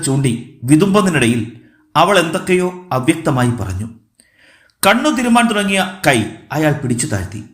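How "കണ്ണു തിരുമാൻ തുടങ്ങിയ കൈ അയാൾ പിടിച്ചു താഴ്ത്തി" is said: usual speaking voice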